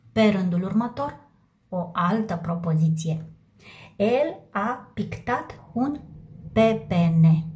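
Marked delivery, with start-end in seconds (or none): 4.0-7.5 slow dictation. Shown as a demonstration. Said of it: "teacher dictates sentence slowly".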